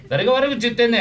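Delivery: loud Kansai-style yell; angry shout. Acted. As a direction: bark it out at the heckler. Shouting at hidden heckler.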